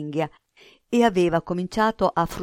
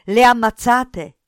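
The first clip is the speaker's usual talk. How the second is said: loud, higher-pitched demand; shocked, insistent interrogation; urgent shouted question; 5 semitones higher, 10 dB louder than usual. A narrator's reading. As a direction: demand the truth from him forcefully. Nuto shakes boy for answer.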